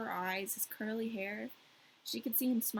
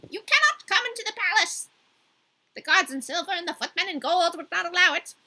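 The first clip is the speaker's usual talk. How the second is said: higher, louder talkative crow; 8 semitones higher, 7 dB louder than usual. Acted. The crow explaining the backstairs to Gerda, higher and louder. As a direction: announce it like a proud crow. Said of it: "difficult to enunciate in this voice".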